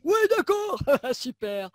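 high, loud genie, laughing and unimpressed; the genie mocks the wish, half laughing. Genie answers her wish.

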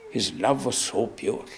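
softly repeating "his love was so pure"; hushed. Acted. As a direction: let it fall to a near hush in awe. Repeated, softer, in awe.

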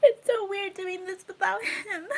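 crying, voice breaking high; real tears, voice cracking. Real, not acted. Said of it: "I'm trying not to cry".

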